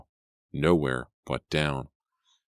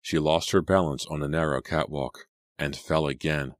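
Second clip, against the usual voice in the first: plain reading voice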